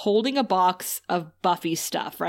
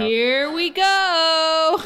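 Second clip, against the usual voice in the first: mock ominous drawl; 7 semitones higher, 4 dB louder, much slower than usual. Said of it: "Okay, [name]".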